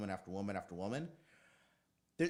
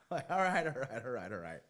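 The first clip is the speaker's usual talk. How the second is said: chuckling all right, all right; laughing; 4 dB louder than usual. Real, not acted. Laughs after mom's reply.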